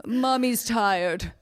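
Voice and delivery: languid, high, slow swooning lady; femme fatale. Pretend. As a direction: drawl it like a drugged Victorian invalid. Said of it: "I could just be like".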